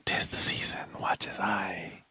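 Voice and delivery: ASMR whisper close to the mic; soft ASMR whisper; whisper in left ear. Pretend. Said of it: "Why are you whispering in my ear".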